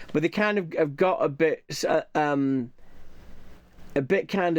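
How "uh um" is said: ordinary talk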